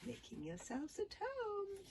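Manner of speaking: high, slow, gruff-edged put-on kidnapper. A narrator's reading. Kidnapper mocking the captured boys, slow and high. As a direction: sneer it like a smug kidnapper. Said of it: "said the first ki-, cat, um, kidnapper".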